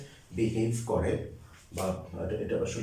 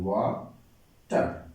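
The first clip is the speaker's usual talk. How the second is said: Water drawn out slowly in two beats, ওয়া then টার; teacher slowly breaking water in two; syllable by syllable; much slower than usual. Shown as a demonstration. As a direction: split water into two slow, separate syllables. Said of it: "ওয়াটারকে আমরা দুইবার উচ্চারণ করতে পারি".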